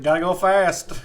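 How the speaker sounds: high, loud cartoon catchphrase; western-style Sonic. Joking with friends, high cartoon hero. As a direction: pitch it up, loud and punchy. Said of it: "I reckon".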